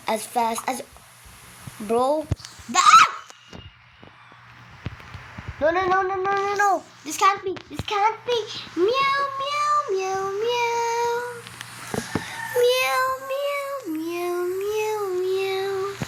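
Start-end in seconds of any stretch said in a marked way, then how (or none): 2.7-3.1 very high loud shriek; scream. Real, not acted. Dies in the water. 5.6-8.4 high loud panicked pleading. Real, not acted. Dying in game. 8.8-15.9 high meowing like a sad kitten; cat voice. Pretend. Meowing after dying.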